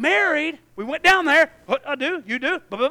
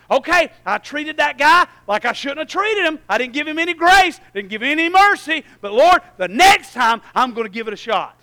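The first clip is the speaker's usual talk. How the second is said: voicing a confession; 3 semitones higher, 9 dB louder than usual. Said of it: "voicing self-reflection".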